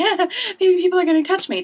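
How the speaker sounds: excited squeal. Retold or imitated. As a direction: squeal it like a starstruck fan. Hoping cast will touch her.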